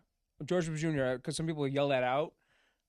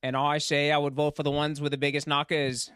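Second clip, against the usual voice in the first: Kennedy; 4 dB louder than usual. Acted. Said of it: "Do Your Kennedy".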